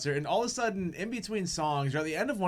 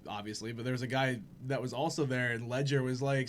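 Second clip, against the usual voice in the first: ordinary talk, nothing marked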